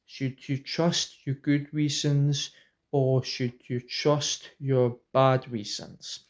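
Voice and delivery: slowly announcing the video title, word by word; drawn-out title announcement; slow title read. Shown as a demonstration. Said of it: "I'll call it".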